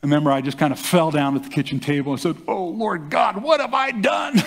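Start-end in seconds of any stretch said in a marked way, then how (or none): 2.5-4.4 high, cracking cry to God, rising into a shout; anguished cry. Retold or imitated. Young man collapsed at the kitchen table crying out to God, high anguished shout. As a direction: pour it out, slumped at the table in dismay.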